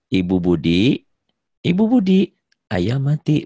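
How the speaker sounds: second Budi called high and loud, then falling; telling Budi's mother the chicken died; urgent news intonation. Shown as a demonstration. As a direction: call out Budi sharply, then deliver the bad news. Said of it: "maksudnya ngasih tahu si ibu Budi".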